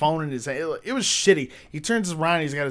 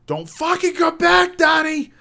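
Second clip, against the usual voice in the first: high, full-force warning yell; shouting after someone; 9 dB louder than usual. Retold or imitated. Chasing the kid out of the store, threatening shout. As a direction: holler it down an empty mall.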